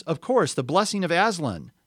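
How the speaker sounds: high, loud, rousing exclamation; excited prince, suddenly roused; cried out. A narrator's reading. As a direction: proclaim it with fervor. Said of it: "cried the prince".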